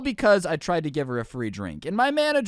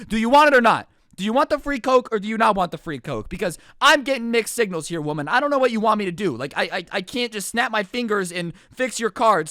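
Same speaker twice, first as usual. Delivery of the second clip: higher, louder fed-up retort; worked-up retold rant; exasperated arguing; 6 semitones higher, 5 dB louder than usual. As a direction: argue back at a difficult customer, exasperated. Retelling his quarrel with customer.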